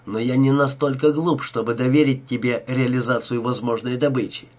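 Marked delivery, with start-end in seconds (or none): none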